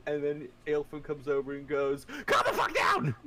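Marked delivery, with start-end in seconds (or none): 2.3-3.2 high, loud mock scream; exasperated shout. Aelfrin coming over to the screamer, exasperated yell. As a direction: yell them down. DM voices Aelfrin yelling at James.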